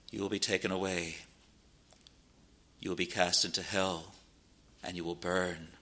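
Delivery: low, soft and solemn; hushed pulpit gravity, dropping away; grave warning. Real, not acted. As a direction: let the threat sink in quietly.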